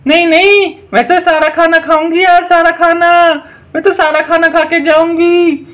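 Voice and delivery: stubborn witch. Acted. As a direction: play a greedy witch who won't budge. Witch insists on eating food.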